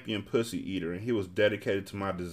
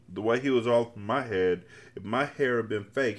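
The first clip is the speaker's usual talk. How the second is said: ordinary delivery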